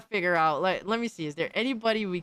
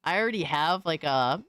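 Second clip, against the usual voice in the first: ordinary talk, nothing marked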